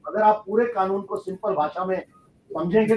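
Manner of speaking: usual speaking voice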